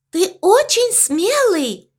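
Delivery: high, slow squeaky admiration; squeaking. A narrator's reading. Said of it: "пропищала овечка".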